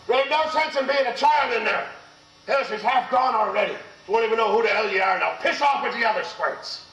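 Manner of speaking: high-pitched scolding yell, imitating great-uncle; retold yell. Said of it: "stands over the top of me and yells".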